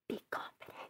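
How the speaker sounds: unvoiced mouthed whisper; half-mouthed whisper of encouragement. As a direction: silently mouth the words, barely breathing them. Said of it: "but, like, in a whisper".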